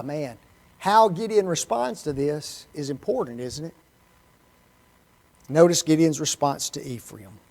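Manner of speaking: normal talking voice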